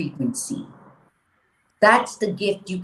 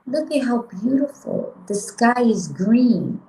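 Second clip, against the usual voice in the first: dreamy donkey; 5 semitones higher, 4 dB louder than usual. Acted. Donkey voiced in a fable.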